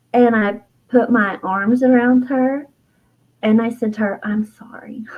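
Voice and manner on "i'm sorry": soft, whispered, tender sorry; near-voiceless, remorseful whisper; whispered apology. Retold or imitated. Woman hugging her inner child, whispered apology.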